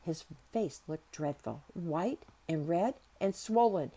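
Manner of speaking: plain reading voice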